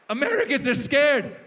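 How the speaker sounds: loud, high comic punchline; emphatic exclamation. Acted. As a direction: cry it out as an exasperated warning. Shouted punchline about fearful Americans.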